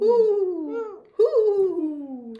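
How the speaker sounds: high, loud owl hoots. Shown as a demonstration. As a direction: hoot like an owl for the child. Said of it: "Can you say who?".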